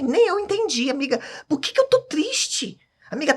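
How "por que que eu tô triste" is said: retold bewildered plea, much higher and slower; high-pitched, drawn-out plaintive question. Telling a friend she can't understand her sadness, plaintive.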